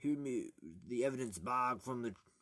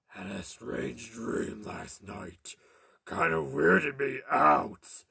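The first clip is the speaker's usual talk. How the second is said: very slow, drawn-out character voice; slow hammy figure, louder at the end; put-on character voice; 8 dB louder, much slower than usual. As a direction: drag it out in a put-on voice, swelling louder.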